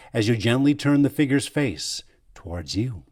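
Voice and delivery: usual speaking voice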